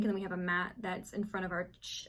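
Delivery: ordinary delivery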